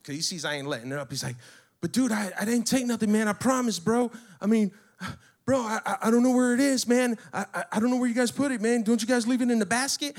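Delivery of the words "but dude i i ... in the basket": high, stammering mock panic; higher, hurried panicky protest; freaking out. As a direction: imitate a guy pleading his innocence. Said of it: "now he's starting to freak out".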